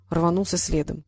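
plain reading voice

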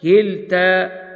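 كلتا drawn out very slowly with a stop on the final vowel; careful slow كلتا at a pause; stopping on kilta. Shown as a demonstration. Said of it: "يوقف عليها بالهاء هكذا".

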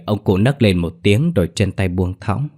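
plain reading voice

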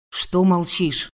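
slow, displeased man's voice. A narrator's reading. Man annoyed at the silent woman on the doorstep, displeased. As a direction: demand an answer, slowly, with displeasure. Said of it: "В мужском голосе звенело недовольство".

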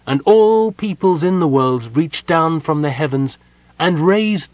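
high, loud zealot's testimony; exalted, ringing declaration; fervent proclamation. A narrator's reading. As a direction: proclaim your god to the whole crowd. Prisoner proclaims her god to all.

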